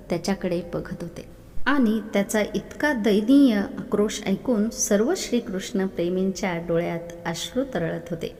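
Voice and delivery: plain reading voice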